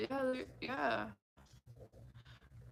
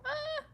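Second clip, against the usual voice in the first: high put-on moan; mock moan. Imitating strangers moaning in a store, high mock moan. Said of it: "When they go".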